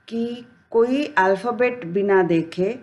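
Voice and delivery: ordinary talk, nothing marked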